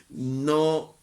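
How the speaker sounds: usual speaking voice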